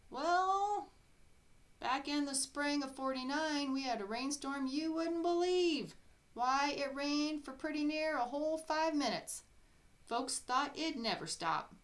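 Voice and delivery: put-on high, loud folksy old-timer voice; old-timer barber. Old barber telling tale.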